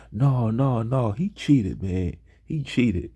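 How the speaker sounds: acted accusation. Classmate accusing him after a math answer, mock indignant. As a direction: play an indignant classmate calling out a cheat.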